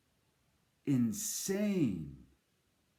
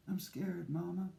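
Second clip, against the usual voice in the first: put-on scared child; 3 semitones higher, 7 dB quieter than usual. Child confesses fear to mother.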